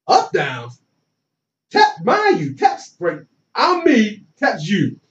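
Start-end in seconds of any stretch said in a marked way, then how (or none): none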